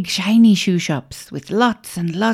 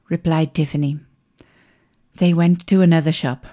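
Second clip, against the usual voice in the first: plain reading voice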